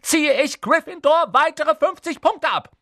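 loud, very high furious scolding; outraged high-pitched tirade; losing her temper. A narrator's reading. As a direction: rage at the pupils as their teacher. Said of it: "verlor sie die Beherrschung".

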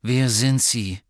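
slow, loud and wary; a wary boy challenging the stranger; suspicious demand. A narrator's reading. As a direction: demand it slowly, distrustful. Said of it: "argwöhnischer".

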